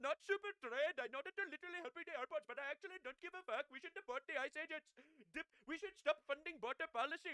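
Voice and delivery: high put-on voice mocking a Democrat; mock liberal voice.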